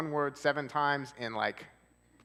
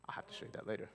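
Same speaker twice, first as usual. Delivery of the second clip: whisper; 8 dB quieter, faster than usual. Real, not acted. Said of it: "No, right".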